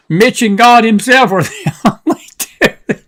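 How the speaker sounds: can't hold back a laugh mid-sentence. Real, not acted. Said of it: "I shouldn't be laughing about it".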